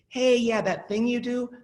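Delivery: imitating a kind boss raising an issue; kindly. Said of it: "very kindly say".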